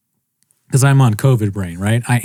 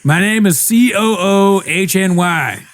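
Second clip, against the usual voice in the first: high, slow letter-by-letter mock spelling; muddled spelling out; 8 semitones higher, slower than usual. Groggy patient spelling his name to the doctor, slow and muddled. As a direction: draw the letters out up high, playing befuddled. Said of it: "I start spelling out my name wrong".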